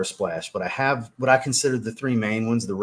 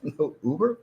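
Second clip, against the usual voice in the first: retold refusal; 5 semitones higher, 3 dB quieter than usual. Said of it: "I'm like".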